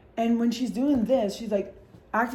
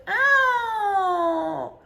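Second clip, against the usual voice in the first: much higher drawn-out ow; mock pained yelp; 11 semitones higher, 4 dB louder than usual. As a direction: yelp like you hit a door. Slams into elevator doors.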